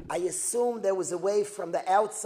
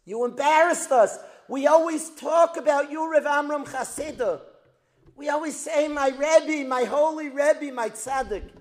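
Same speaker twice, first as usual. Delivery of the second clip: higher, louder wounded protest; hurt reproach; 6 semitones higher, 8 dB louder than usual. Retold or imitated. Students scolding their rebbe, high loud hurt reproach. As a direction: let the shame and hurt spill out loud. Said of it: "And the Talmidim say to Rav Amram Chasida".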